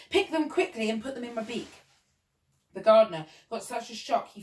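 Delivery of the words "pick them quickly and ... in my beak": usual speaking voice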